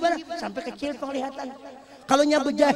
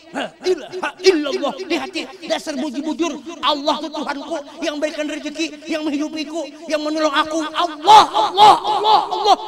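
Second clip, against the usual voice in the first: raised voice building to loud Allah; heartfelt dhikr; 4 semitones higher, 4 dB louder than usual. Shown as a demonstration. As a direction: build into emphatic, loud invocations. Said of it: "Kayak itu juga orang berzikir dihayati".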